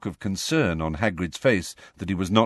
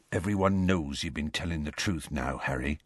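soft, gentle, much quieter; softly; 5 semitones lower, 7 dB quieter than usual. A narrator's reading. Hagrid comforting Harry across the table, soft and quiet. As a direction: say it softly, almost tender. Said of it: "said Hagrid softly and unexpectedly".

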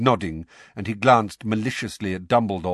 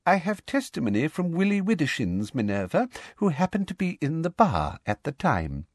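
high, smug little voice; self-satisfied testimony; smugly precise, pitched up; Umbridge, smug; 6 semitones higher than usual. A narrator's reading. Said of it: "said Umbridge smugly".

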